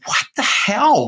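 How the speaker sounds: high-pitched, drawn-out incredulity; stunned disbelief. Retold or imitated. Retelling his reaction to the developer's sales, stunned disbelief. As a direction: let the voice leap up, slowly, in astonishment.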